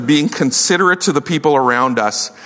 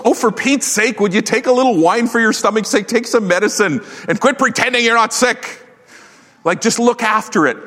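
exasperated Paul; 8 semitones higher than usual. Retold or imitated. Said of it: "Paul goes".

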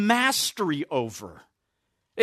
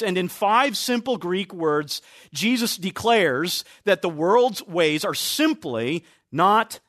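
normal talking voice

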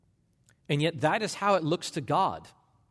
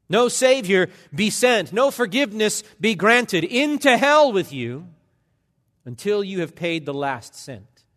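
loud, higher, mounting sternness; strict justice; 3 semitones higher, 5 dB louder than usual. Acted. Preacher voicing strict justice, loud and rising. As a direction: build to "Into hell with you".

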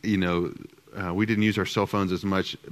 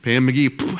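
normal talking voice

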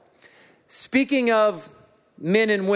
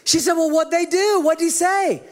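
higher, incredulous wife voice; exasperated wife; 8 semitones higher than usual. Retold or imitated. Pastor voices his wife grilling him about the trip, higher and exasperated. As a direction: press him with each question. Voicing wife's questioning.

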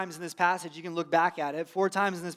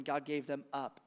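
softer, grave God gave them up; hushed; 4 semitones lower, 9 dB quieter than usual. Shown as a demonstration. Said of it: "reminds us time and time".

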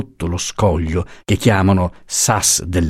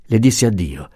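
usual speaking voice

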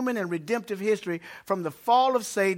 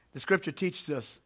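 ordinary talk, nothing marked

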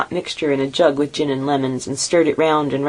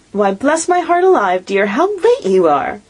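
higher, slowing fond scolding; doting mother; 7 semitones higher, 4 dB louder than usual. A narrator's reading. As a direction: fuss over her late daughter. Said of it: "said Missus Cratchit".

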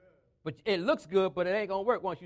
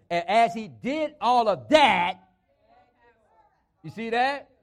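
loud, emphatic sermon build; forceful preaching; 3 semitones higher, 9 dB louder than usual. Real, not acted. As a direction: hammer the key words to the congregation.

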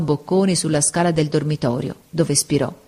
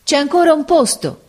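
much higher, louder call; sailors shout; 11 semitones higher, 4 dB louder than usual. A narrator's reading. Sailors shout to the passengers for one more, loudly. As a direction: call out over the noise. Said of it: "gridarono allora i marinai".

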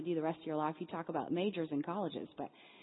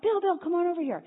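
higher, louder call across the yard; yelling across; 11 semitones higher, 8 dB louder than usual. Retold or imitated. Calling her friend Bill over to meet the boy, loud and high. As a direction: shout over to a friend nearby. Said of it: "I yelled over to my friend Bill".

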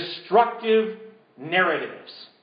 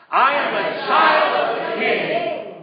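slow, high, shouted declaration; full-voiced congregational shout; loud as you can; 6 semitones higher, 6 dB louder, slower than usual. Shown as a demonstration. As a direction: yell it at the devil. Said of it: "say as loud as we can".

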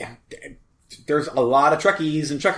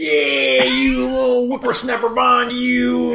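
high shouting cranky geezer voice; old prospector; 11 semitones higher, 4 dB louder, slower than usual. Pretend. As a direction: holler like a furious old codger. Joins the old man rant.

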